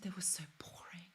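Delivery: hushed whisper of a guilty friend; imitated friend's sheepish whisper; whispered excuse. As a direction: whisper it like a naughty confession.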